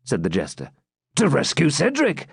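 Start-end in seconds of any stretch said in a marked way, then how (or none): 1.2-2.3 higher, resolute swineherd; rally your friend to action. A narrator's reading. Swineherd voiced.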